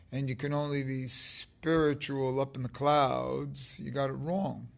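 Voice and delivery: ordinary talk, nothing marked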